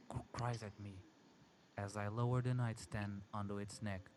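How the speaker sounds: ordinary delivery, nothing marked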